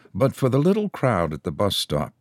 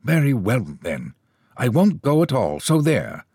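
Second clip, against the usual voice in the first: high, huffy snap of a waspish little woman; snapped out; 6 semitones higher than usual. A narrator's reading. Waspish woman snapping at her companion in the queue. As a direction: bite off the words and flounce away. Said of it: "snapped out at a man".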